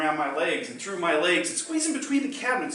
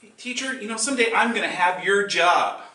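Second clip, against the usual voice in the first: cheeky student; 6 semitones higher, 7 dB louder than usual. Retold or imitated. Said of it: "the student talking to the teacher".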